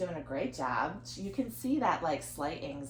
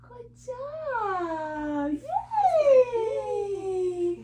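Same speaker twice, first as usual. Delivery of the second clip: put-on high and louder cheering for the baby; excited praise; 8 dB louder, much slower than usual. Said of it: "keep praising her".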